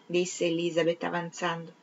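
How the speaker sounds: plain reading voice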